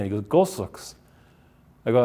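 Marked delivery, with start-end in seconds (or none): 0.2-1.0 mock German accent repeating the odd word; lightly voiced German runner; a touch of German on Gulsox. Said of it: "had this thick German accent".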